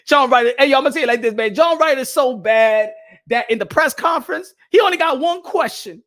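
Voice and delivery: loud, punchy punchline delivery; loud ranting joke. Real, not acted. Vlogger loudly mocks John Ryder's press conference. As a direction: shout the joke at the crowd.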